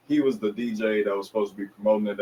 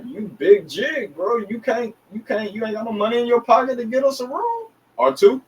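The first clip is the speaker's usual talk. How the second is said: higher, slowed then rising complaint at his partner; mock scolding; 12 semitones higher, 3 dB louder than usual. Scolding his partner about the hotel room, mock higher whine. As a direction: whine up at him in disbelief.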